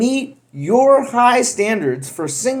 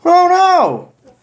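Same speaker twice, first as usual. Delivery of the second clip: hammed-up high, loud gasp of dismay; high, loud mock dismay; mock alarm; 9 semitones higher, 5 dB louder than usual. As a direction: play-act a little panic. Mock alarm at empty shelves.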